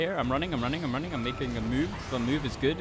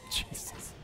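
startled gasp; 12 dB quieter than usual. Real, not acted. Startled, breathless.

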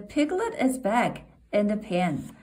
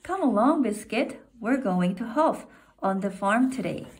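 plain reading voice

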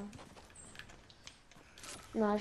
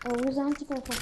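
ordinary talk, nothing marked

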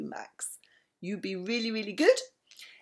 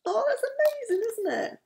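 very high, thrilled coo; delighted gushing. Real, not acted. Nana reacting to the story's happy ending, delighted high gush.

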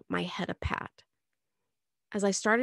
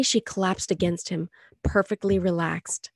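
ordinary delivery, nothing marked

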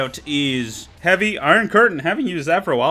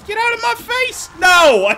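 much higher put-on voice, shrieked; a hammed-up high squeal of a taunt; squeaky taunt; 5 dB louder than usual. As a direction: squeal it out like a cartoon character. Taunting a spy.

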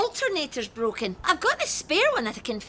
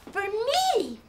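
ordinary talk, nothing marked

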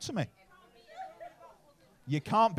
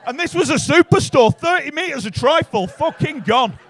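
loud and laughing; rising excitement, half shouted; louder over the laughter; excited shout; 6 semitones higher, 12 dB louder than usual. Real, not acted. Excited punchline to crowd.

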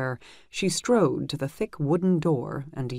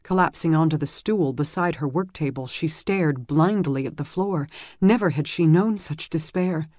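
ordinary delivery

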